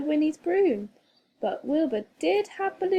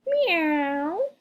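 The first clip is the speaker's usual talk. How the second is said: cat meow; 5 semitones higher than usual. A narrator's reading. The cat Wilbur speaks.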